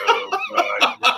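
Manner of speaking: high, laughing "oh God"; laughing exasperation; mock groan. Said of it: "I'm going like".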